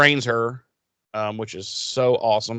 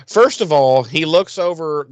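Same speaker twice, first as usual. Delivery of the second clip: ordinary talk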